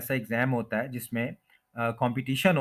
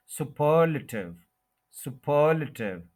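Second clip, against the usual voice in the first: Superlative as su-PER-luh-tiv; superlative with heavy second syllable, quick weak luh; stress on second syllable; slower than usual. Shown as a demonstration. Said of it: "इसको short करना है".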